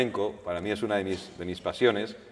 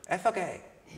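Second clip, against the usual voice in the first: put-on Andalusian accent; 9 semitones higher, 4 dB quieter than usual. Flamenco singer scoffing at reading to the reporter, Andalusian accent. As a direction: scoff it in a rough Jerez Andalusian accent, pitched up. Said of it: "con su acento así".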